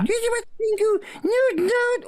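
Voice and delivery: very high squeaky penguin cry; Pingu voice. Retold or imitated. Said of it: "all that stuff".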